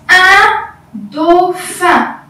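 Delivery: very slow, drawn-out "Un dauphin"; presenting a word. Shown as a demonstration. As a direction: introduce "Un dauphin" syllable by syllable. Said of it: "Maintenant, on passe".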